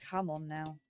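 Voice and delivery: normal talking voice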